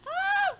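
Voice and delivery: high, loud scream; real squeal mid-game; startled shriek. Real, not acted. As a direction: let out a sudden shrill cry. Startled during play.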